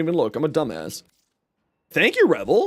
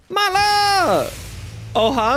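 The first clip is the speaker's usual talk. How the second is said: higher, excited call of her name; excited greeting; 12 semitones higher, slower than usual. Real, not acted. Streamer happily calls out to the arriving raider, excited.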